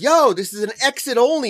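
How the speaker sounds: imitating an irked driver; indignant confrontation. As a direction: call out the offender in disbelief, retold. Retelling angry driver confronting woman.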